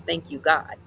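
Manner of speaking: plain reading voice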